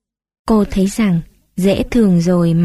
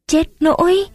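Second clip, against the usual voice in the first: even higher rising cry on nỗi; Chết nỗi with nỗi pitched up higher still; dismayed gasp; 9 semitones higher than usual. A narrator's reading. Tuất asks why her brother was jailed, alarmed and high.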